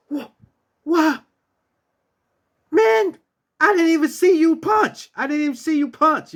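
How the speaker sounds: a coworker who can't believe what he saw; shocked. Retold or imitated. Maintenance guy stunned after seeing the knockout, high and stammering. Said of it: "was shocked".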